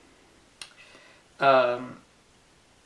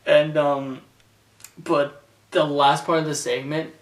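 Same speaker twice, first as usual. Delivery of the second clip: ordinary delivery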